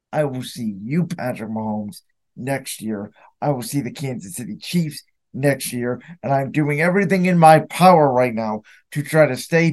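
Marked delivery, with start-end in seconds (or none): 0.1-6.0 low, soft, menacing promise; simmering revenge vow to the rival quarterback; quiet vow. Real, not acted. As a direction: lean in and threaten under your breath. Vows revenge on Mahomes.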